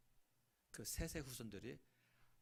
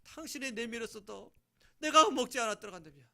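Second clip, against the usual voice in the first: pleading regret; 12 semitones higher, 15 dB louder than usual. Acted. Adam pleading regret to Eve.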